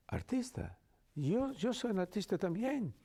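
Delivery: high-pitched excited guard imitation. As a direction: mimic a guard thrilled to be an actor too. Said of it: "He said".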